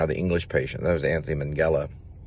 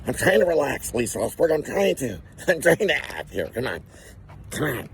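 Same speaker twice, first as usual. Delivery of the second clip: higher-pitched, straining would-be actor; strained acting student; 6 semitones higher than usual. Himself as a student in acting class, strained and high. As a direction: play a nervous student trying to act. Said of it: "That's me walking in an acting class".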